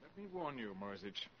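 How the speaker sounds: very soft, close menace; hushed threat. Acted. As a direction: lean in and warn him quietly.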